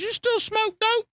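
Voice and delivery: high, slow mock old-timer voice; Larry's question. To the young narrator, Larry asks about dope in a high slow put-on voice. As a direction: drawl it out like a clueless older buddy. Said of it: "he goes".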